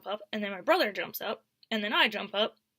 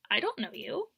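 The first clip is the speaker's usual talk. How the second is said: ordinary talk, nothing marked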